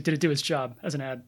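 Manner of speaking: normal talking voice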